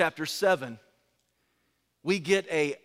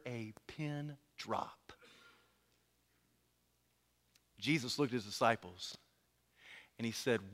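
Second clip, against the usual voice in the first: much softer and lower, nearly a whisper; hushed; 8 semitones lower, 10 dB quieter than usual. Acted. Jesus asking his disciples quietly, hushed low question. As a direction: speak into the silence.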